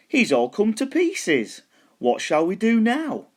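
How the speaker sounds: high, slow, puzzled skeleton; a skeleton dismayed at the bones; big skeleton voice. A narrator's reading. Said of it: "the big skeleton said".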